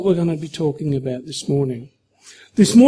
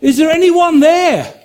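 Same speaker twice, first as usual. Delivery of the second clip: higher, anxious call from a man dangling off a cliff; man calling for help; 8 semitones higher, 4 dB louder than usual. Acted. Man hanging off a cliff calls out, higher and anxious. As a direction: call out into the void, hoping someone hears. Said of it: "The man says".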